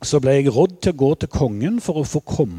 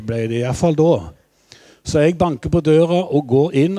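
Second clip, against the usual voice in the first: ordinary delivery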